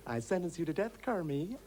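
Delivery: comic high judge, rising at the end; Kermie's co-star impression. Shown as a demonstration. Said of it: "How does it look?".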